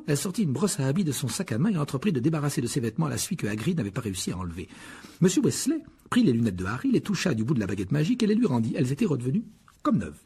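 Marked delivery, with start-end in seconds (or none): none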